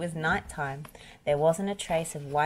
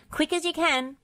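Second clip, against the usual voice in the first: high-pitched urgent push; urging; 12 semitones higher than usual. A narrator's reading. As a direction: hurry them along, pitched well up. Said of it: "urged Bob".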